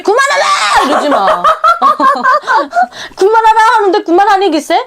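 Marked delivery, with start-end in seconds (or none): 0.0-0.4 father snapping. Retold or imitated. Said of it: "그러니까 막 짜증내지". 3.2-3.6 louder mock snap broken by laughter; father snapping, laughing. Father telling the nagging mother to stop, laughing mock yell. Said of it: "그러니까 막 짜증내지".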